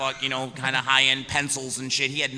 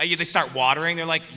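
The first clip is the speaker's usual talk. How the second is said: ordinary talk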